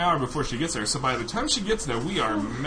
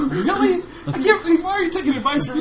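laughing disbelief; 7 dB louder than usual. Retold or imitated. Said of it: "I'm like".

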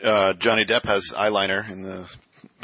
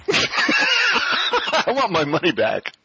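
laughing shout; 12 semitones higher, much slower than usual. Real, not acted.

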